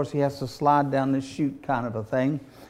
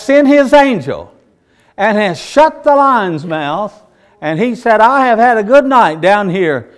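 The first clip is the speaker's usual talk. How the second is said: resounding shout up from the den, higher and drawn out; big old-man voice; 6 semitones higher, 9 dB louder than usual. Retold or imitated. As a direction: imitate the old prophet, loud and triumphant. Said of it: "Big old voice from eighty-four-year-old man".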